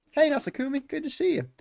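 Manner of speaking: put-on high, bright girl greeting; cheerful girl voice. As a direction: pitch way up to greet a friend. Voicing cheerful Maya greeting.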